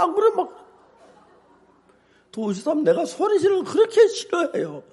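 ordinary delivery

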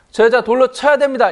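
high, loud mock accusation; a high, loud mimicked demand from accusers; imitated accusing crowd. Accusers demanding the woman's stoning.

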